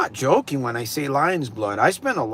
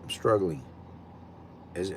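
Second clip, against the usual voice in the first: ordinary talk, nothing marked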